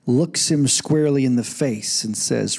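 ordinary talk, nothing marked

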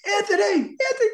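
very high, loud call; high-pitched holler across a party; crying out. Retold or imitated. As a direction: imitate someone calling out a name excitedly. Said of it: "And he'll cry".

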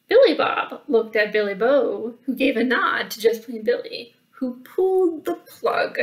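lively read-aloud storyteller; animated children's story reading; story narration. A narrator's reading. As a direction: read it like a picture book to kids. Reads picture book aloud.

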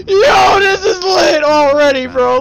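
loud excited yell; excited shout. Real, not acted. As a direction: yell with hype. Hyped by the cutscene.

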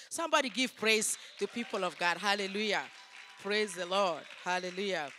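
loud, ringing call to the congregation; rousing praise call. Real, not acted. Preacher urging the congregation to give praise, loud rousing call.

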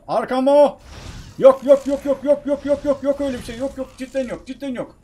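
high, loud rapid-fire denial; frantic, refusing what's behind you; panicked. Real, not acted. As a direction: start at a yell and ease down, voice staying high. Monster right behind him.

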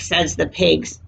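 ordinary delivery, nothing marked

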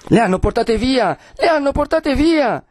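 high, drawn-out cry of alarm; alarmed outcry. Acted. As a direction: announce a kidnapping to the crowd. Crowd cries out the women are gone. (grido di sgomento, acuto)

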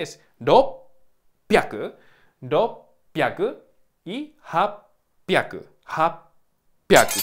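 "ropyaku" and "hapyaku" said slowly, breath fully cut at the doubled p; stopped airflow. Shown as a demonstration. Teacher showing a student how "ropyaku" stops the air on the p. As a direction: hold the p closed with no air, then release "pyaku". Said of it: "Hay que interrumpir, o sea, cuando dices rop, no sale el aire".

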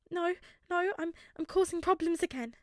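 high, loud, sniffling plea; tearful. A narrator's reading. Boy insisting to his classmate, tearful. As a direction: push the words out between sniffles. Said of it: "he whispered through sniffles".

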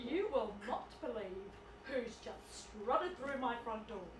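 low, soft, slow confiding; hushed aside. Acted. Hostess confides to Janet that her brother arrived, hushed aside. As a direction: drop right down to a secretive undertone.

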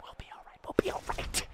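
very soft, voiceless whisper; whispered reassurance. Real, not acted. Player reassuring himself after the stoppage, whispered. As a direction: whisper it like a self-soothing mantra.

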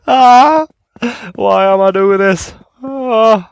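loud, high scream of fright, slow in the middle; terrified scream. Real, not acted. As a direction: scream as it chases you. Screaming while fleeing monster.

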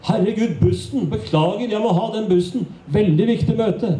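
high, hurried panic about catching the bus; mock flustered escape; frantic excuse. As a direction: blurt the excuse and run. Fleeing the conversation onto a bus.

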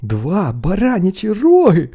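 slow, high, loud boast; proud, stretched exclamation; excited announcement. A narrator's reading. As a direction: savour every word of your find. Boy proudly announces his find.

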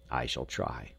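low, soft resolve; quiet, humbled promise; subdued. A narrator's reading. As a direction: drop low and soft, almost to oneself.

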